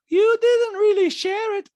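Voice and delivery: loud, high, slow mimicry; Diana. Diana disputing Sutton's story, loud high impression. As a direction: contradict up high in her voice. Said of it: "Diana's like".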